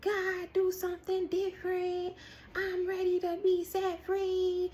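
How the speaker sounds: high, softened, drawn-out plea; pleading prayer. Retold or imitated. Prisoner crying out to God for freedom, high pleading. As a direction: act out begging God for release.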